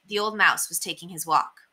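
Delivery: ordinary delivery, nothing marked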